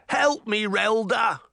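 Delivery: high, panicked plea; desperate cry. A narrator's reading. Jack begging the grandmother for help, desperate cry. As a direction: cry out for rescue. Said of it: "Jack cried".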